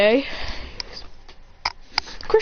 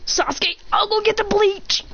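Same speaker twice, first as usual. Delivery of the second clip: high-pitched dramatic call; urgent announcement; 10 semitones higher than usual. Acted. Boy calling to his rival after the kiss, high urgent voice.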